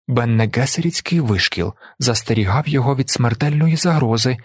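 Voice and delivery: ordinary delivery, nothing marked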